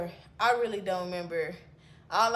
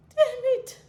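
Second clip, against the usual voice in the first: high, loud squeaked curse; squeaky dismay; 7 dB louder than usual. Retold or imitated. Hearing he offers nothing at all, squeaked dismay. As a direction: yelp it in mock dismay.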